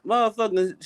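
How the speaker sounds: ordinary talk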